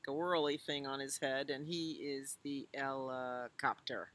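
plain reading voice

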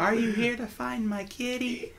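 put-on high, soft little girl voice. GM voicing a little girl asking the party about her kitty. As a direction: squeak it out like a small shy child. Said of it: "she's got a little voice and goes".

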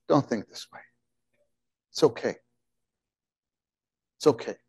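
soft, soothing inner voice; hushed, comforting words; gentle reassurance. Acted. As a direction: reassure quietly, leaving space between phrases.

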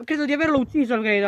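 normal talking voice

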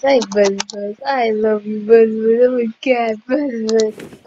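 loud, doting greeting; excited gushing. Real, not acted. Player greeting Buzz Buzz, excited gushing. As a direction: gush over a beloved pet. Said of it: "Enough of me getting all excited".

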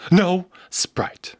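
hushed "No Sprite"; whispered order. Acted. Mock drive-thru order, whispered. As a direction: whisper it as a joke.

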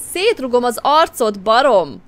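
put-on loud furious yell at the drunks; angry threat. Heroine yells "szétrugom az arcod" at the drunk men, loud and furious. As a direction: spit "szétrugom az arcod" out in anger.

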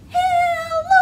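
very high squeaky mouse hello. Shown as a demonstration. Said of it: "you need a squeaky mouse voice".

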